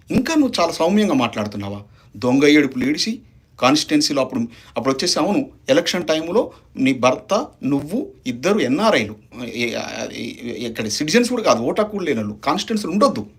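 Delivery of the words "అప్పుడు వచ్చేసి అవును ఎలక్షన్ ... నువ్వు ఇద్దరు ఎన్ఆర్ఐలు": ordinary talk, nothing marked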